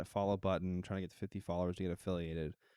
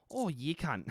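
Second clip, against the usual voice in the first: higher, drawn-out mock Aussie exclamation; Australian accent; 5 semitones higher, 3 dB louder than usual. Streamer imitates Australians for a friend, broad mock Australian accent. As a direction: play a caricature of an Australian. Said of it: "Australians just like".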